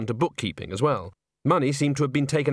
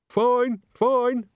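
muffled voice; 11 semitones higher, 4 dB louder than usual. Acted. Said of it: "said a muffled voice".

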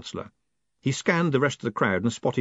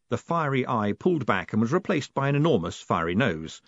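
ordinary delivery, nothing marked

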